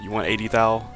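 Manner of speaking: ordinary talk, nothing marked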